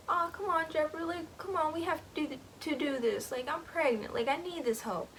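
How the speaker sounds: high, urgent pleading; pleading wife. Retold or imitated. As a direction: beg her husband to accept help. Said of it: "the wife was like".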